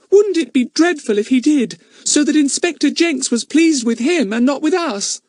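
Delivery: put-on high, worried little girl; Bets, girl's voice. Anxious girl to her friends, high and fretful. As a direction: fret that the policeman might outshine them.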